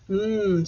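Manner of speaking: "M" as a sustained mmm hum; letter sound demo. Shown as a demonstration. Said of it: "I hear the M sound".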